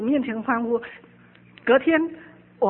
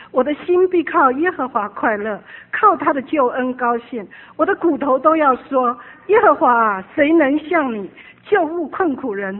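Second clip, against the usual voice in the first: fervent scripture reading; 5 dB louder than usual. Real, not acted. Preacher reading Psalm 35 aloud to the congregation, fervent. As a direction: declaim the verse with conviction.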